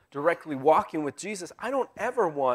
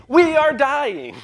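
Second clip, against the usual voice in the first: slow, much higher and louder panicked wail; terrified disciples yelling; frantic cry; 10 semitones higher, 11 dB louder, slower than usual. Retold or imitated. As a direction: cry out as the boat sinks. Apostles panicking in storm.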